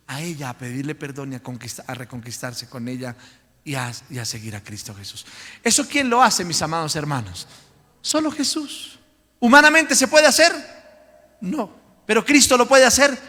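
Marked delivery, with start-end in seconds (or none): 5.6-13.2 loud, higher fiery preacher questions; preaching at full force. Acted. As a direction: fire rhetorical questions at the congregation. Predicador exclama preguntas a la iglesia. (predicación a todo pulmón, fuerte y aguda)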